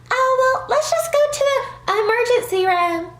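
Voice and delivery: high, sing-song imitation of her nagging; mocking the roommate. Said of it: "And she's like".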